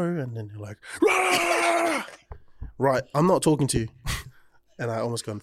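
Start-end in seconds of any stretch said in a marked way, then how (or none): 1.0-2.0 high, loud roar at a caller; angry yell. Retold or imitated. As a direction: blast out a furious yell. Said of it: "angry people who just yell at you".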